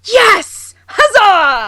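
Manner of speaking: high bright cheer of triumph. Real, not acted. Friend cheers the rousing quote, triumphant shout. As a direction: yes and huzzah, whooped high.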